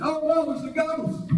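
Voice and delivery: high, slow frightened cry of the disciples; terrified cry. Retold or imitated. Preacher voicing the terrified disciples, high and fearful. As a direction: gasp it out like frightened fishermen.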